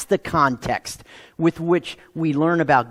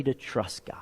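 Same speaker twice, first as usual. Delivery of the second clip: whispered emphasis; 6 semitones lower, 11 dB quieter than usual. Real, not acted. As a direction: whisper the key words. Sermon key point dropped low.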